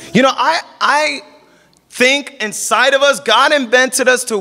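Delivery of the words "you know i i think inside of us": normal talking voice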